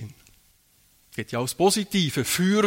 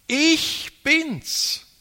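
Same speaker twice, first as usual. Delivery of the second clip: "Ich bin's" proclaimed much higher, "Ich" stressed; emphatic key word; 11 semitones higher than usual. Shown as a demonstration. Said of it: "Das ist das entscheidende Wort gewesen". ("Ich bin's" hoch und feierlich betont)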